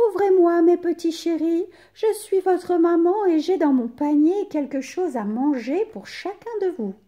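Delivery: wolf faking a high mother's voice; soft voice. A narrator's reading. Wolf at the door pretending to be mother, high voice. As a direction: coax them sweetly, pitched high. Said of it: "dit d'une voix douce".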